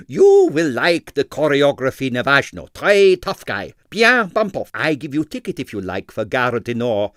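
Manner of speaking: put-on knowing showman's advice, accent and all; loud sagely Stroganoff voice; sage impresario. A narrator's reading. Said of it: "said Stroganoff sagely".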